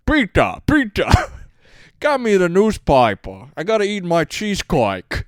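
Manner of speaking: put-on high-pitched call in a Sicilian Australian accent; a Sicilian Australian man calling for Pita; old Sicilian Australian man calling to the dad, in a Sicilian Australian accent. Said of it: "my dad will always do the impression".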